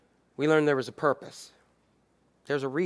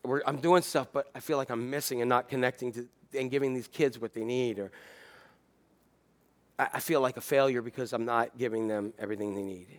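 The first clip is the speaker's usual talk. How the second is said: overwhelmed teacher; 6 semitones lower, 8 dB quieter than usual. Retold or imitated. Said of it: "educators who feel overwhelmed".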